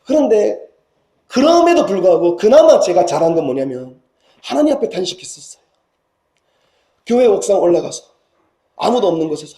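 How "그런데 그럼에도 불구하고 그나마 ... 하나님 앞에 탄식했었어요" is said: ordinary delivery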